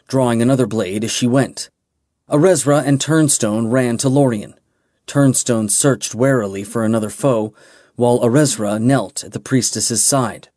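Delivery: ordinary delivery, nothing marked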